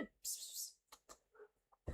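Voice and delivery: soft unvoiced psst to the dog; psst calling. Real, not acted. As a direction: hiss to get the dog's attention.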